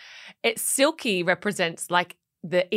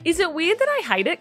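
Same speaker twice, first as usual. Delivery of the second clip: ordinary talk, nothing marked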